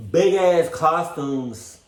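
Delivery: "big-ass costumes" slow, high and loud; slow loud disgust; drawn-out emphasis. Real, not acted. Ranting about costumes.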